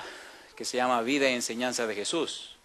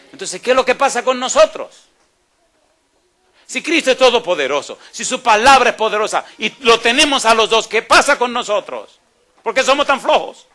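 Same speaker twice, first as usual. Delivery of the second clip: loud, high fiery preaching; full-force preaching; 9 semitones higher, 11 dB louder than usual. Acted. Preacher scolds the congregation for being lazy, loud fiery preaching. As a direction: hammer the question at the congregation.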